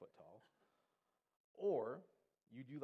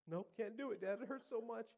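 high, loud mock wail; hammed-up childish complaint; whining hurt kid; 11 semitones higher, 4 dB louder than usual. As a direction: give up like a boy in pain. Hurt boy giving up to dad.

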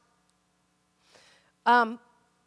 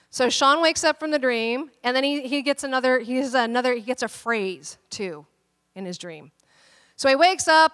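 ordinary talk

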